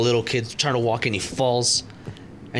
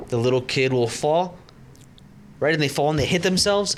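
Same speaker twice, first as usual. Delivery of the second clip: ordinary talk, nothing marked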